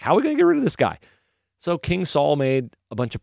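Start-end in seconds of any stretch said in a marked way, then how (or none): 0.0-1.0 high, hammed-up worried voice; mock fearful. Israelites cowering before the giant, mock fearful. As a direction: play the cowering soldier, pitched way up. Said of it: "They're all cowering in fear".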